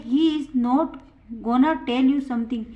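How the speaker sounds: slow, clear example for students; teacher reading it out slowly to the class; slow model sentence. Shown as a demonstration. Teacher models the sentence, slow and clear. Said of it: "दूसरा example है".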